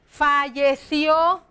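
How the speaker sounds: "Falleció" very slowly, ending on a long rising "ció"; stress on final ó. Shown as a demonstration. Teacher showing the accent, "Falleció" stressed on the final ó. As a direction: stretch out "Falleció" towards its accented ó. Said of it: "también tiene acento acá en la O".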